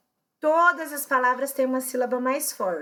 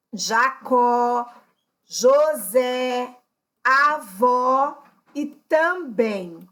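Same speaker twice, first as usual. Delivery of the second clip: "Jacó, José, avó e também", each final syllable leaned on; slow list, hitting CÓ, ZÉ, VÓ and BÉM; stress on last syllable; much slower than usual. Shown as a demonstration. Teacher giving oxytone examples, last syllable stressed in Jacó, José, avó, também. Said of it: "a sílaba mais forte".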